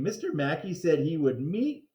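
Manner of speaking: ordinary delivery, nothing marked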